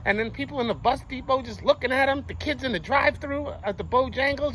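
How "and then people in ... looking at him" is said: usual speaking voice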